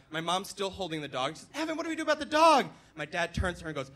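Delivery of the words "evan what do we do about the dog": high, loud, fast plea; panicked mom. Retold or imitated. Mom still holding dog asks husband, frantic cry. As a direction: blurt it at your husband in alarm.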